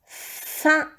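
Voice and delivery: whispered syllable, breathy and toneless; whispered dictation. Shown as a demonstration. Teacher dictating a syllable to the pupil, whispered. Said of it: "Troisième syllabe".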